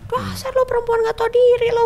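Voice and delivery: high, loud furious accusation; scornful shouted accusation in a café; ex-husband yelling insult. Retold or imitated. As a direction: spit the insult at her like a raging husband. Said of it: "Itu langsung aku dituding".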